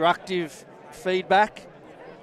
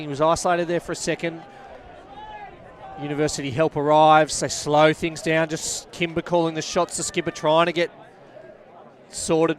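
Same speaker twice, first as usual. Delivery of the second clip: brisk rugby commentary call; lively sideline commentator; live play-by-play; 4 dB louder than usual. Acted. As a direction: call the match as it unfolds, punching the big moments.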